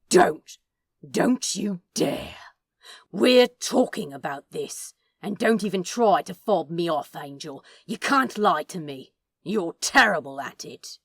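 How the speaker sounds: loud, forceful scolding; Crowley forbids the angel to leave, loud and hard; angry command. Acted. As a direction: slam the door on the excuse. Crowley angry, stopping angel leaving.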